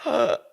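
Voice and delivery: mimed gasp of surprise; imitated gasp. Husband sees the pregnancy test, imitated gasp. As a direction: gasp as the news clicks. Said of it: "And then he's like".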